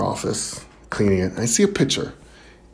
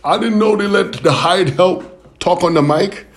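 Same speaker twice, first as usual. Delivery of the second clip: mock heckler shout; 4 semitones higher than usual. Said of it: "he yells out".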